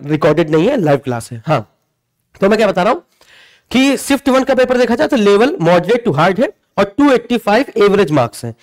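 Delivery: ordinary talk, nothing marked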